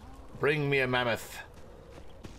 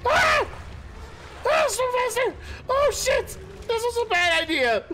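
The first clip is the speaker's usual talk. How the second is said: panicked screaming; 12 semitones higher than usual. Real, not acted. Player fleeing a charging mammoth, screaming in panic. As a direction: scream while fleeing for your life.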